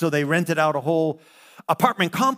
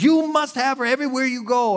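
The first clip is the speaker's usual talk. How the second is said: insistent pimp quote; 8 semitones higher than usual. Retold or imitated.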